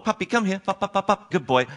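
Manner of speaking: high, friendly puppy call; coaxing call. Shown as a demonstration. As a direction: lure the dog in sweetly. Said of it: "it's simple lure and reward".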